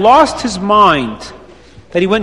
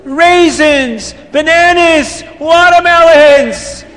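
high, slow, sing-song list of fruits; excited list; 7 semitones higher, much slower than usual. Acted. Reb Chaim recites the punch fruits, high and gleeful. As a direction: savour each fruit like a secret code.

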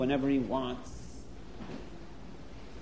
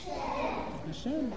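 hushed, breathy answer; whispered answer; 10 semitones higher, 12 dB quieter, slower than usual. Shown as a demonstration. Storyteller answering her own question for kids, whispered. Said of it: "Who is stronger, Hashem or a bear?".

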